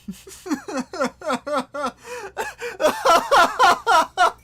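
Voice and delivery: high, loud helpless laughter; long loud real laugh; big laugh. Real, not acted. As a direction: burst out cackling. Laughing at game chaos.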